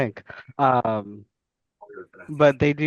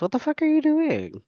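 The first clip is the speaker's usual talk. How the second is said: exclaiming; 14 semitones higher than usual. Real, not acted. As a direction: pitch jumps up on the question. Mock scolding viewers who don't subscribe.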